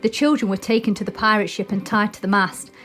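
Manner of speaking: usual speaking voice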